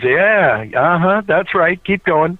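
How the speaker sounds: drawn-out, knowing agreement; egging on. Retold or imitated. Manager prompting a guest's guess, knowingly encouraging. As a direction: coax the guess along in a slow, lifted voice.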